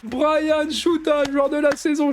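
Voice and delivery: big drawn-out shout for the star player; excited commentary. Acted. Gamer hailing his star striker, commentator shout. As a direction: hail the season's top scorer like a TV caller.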